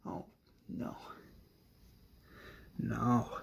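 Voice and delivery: very soft, sinking disbelief; quiet despair. Acted. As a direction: let it drop almost to nothing. Acted despair as countdown ends.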